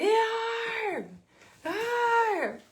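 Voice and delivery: reenacted pained groan, cried out high and loud; two desperate high groans, reaching out; pained groaning call. Calling her husband from the bedroom doorway, high pained groans.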